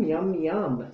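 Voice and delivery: ordinary delivery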